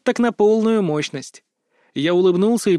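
ordinary delivery

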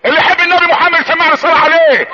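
high, ringing preacher's call; rousing congregational call. Real, not acted. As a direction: summon the crowd to respond. (نداء خطابي عالي للمصلين)

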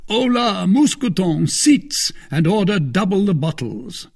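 high, loud host ordering servants; lordly, hearty Porthos. A narrator's reading. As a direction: play the grand host, pitched high.

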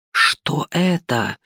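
slow, awed question; amazed. A narrator's reading. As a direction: gasp it out in wonder. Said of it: "изумлённо воскликнула она". (изумлённо)

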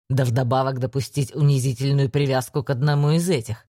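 low, contemptuous lecture; scornful low voice. Acted. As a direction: look down on him with every word. Ander scolds, lower voice.

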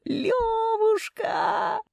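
very high, stretched-out wail; long hysterical cry; howling. A narrator's reading. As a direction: howl the pet name for help. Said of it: "провыла она".

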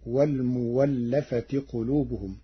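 والمولفة with a pure waw, walmuwallafati; Warsh reading. Shown as a demonstration. Teacher demonstrating the Warsh form والمولفة. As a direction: say والمولفة with w in place of hamza. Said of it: "فتصير".

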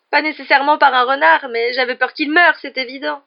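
high, loud tearful retort; distraught. A narrator's reading. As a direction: protest through acted tears. Distraught Lavande answering back.

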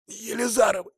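hoarse, squeezed chest; hoarse wheeze. A narrator's reading. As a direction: wheeze it out while pinned down. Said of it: "прохрипел я через сдавленную грудь".

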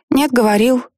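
put-on playful little girl. A narrator's reading. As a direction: play it as a game. Child treats argument as game.

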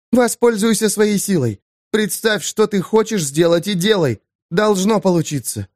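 lightly voiced woman, firm and quick; Eva's voice. A narrator's reading.

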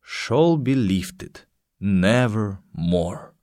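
slow, solemn poetry reading; poem recitation. Acted. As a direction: intone the verse slowly. Teacher reciting The Raven slowly.

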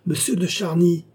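high-pitched call from a window. A narrator's reading. Queen calling to Charny from the open window, calling out. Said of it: "appela de sa voix".

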